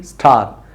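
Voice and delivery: स्टार low and soft with a clean s; right star, soft. Shown as a demonstration. Teacher softly models the correct स्टार. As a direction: say स्टार with no added vowel. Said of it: "स्टार नहीं".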